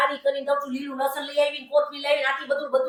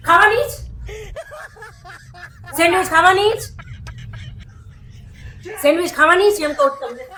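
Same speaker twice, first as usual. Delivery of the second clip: angry; 3 semitones higher than usual. Acted. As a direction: insist angrily that he eats the sandwich. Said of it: "એટલે તું પ્રેમથી કહેતી ગુસ્સામાં?". (ગુસ્સામાં ધમકાવીને)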